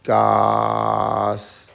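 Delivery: gas as a long held gahhh-sss; loud, drawn-out gas with an ah vowel; stretched sounding out. Shown as a demonstration. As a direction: stretch gas slowly, vowel then hiss. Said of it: "So we'll say".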